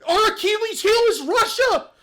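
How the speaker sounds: mocking loud exclaim. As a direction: ham up her sudden alarm, slow and loud. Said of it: "Thirty seconds later".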